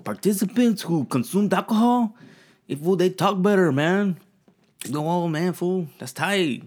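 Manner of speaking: high, loud East LA reading voice; East LA accent. Shown as a demonstration. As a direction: read the study like an East LA homeboy. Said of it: "I'm gonna read you this in East L A".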